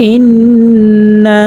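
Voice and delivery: ইন্না with a long nasal ন্ন, very slow; ghunnah recitation. Shown as a demonstration. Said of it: "গুন্না করিয়া পড়তে হবে". (ইন্না-তে ন্ন নাকে টেনে)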